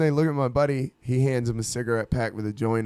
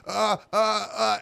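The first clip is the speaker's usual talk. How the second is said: raised, stunned "uh" noises; stunned wordless stammer; 8 semitones higher, slower than usual. Retold or imitated. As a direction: imitate someone too stoned to speak. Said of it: "nonverbal. We're just like".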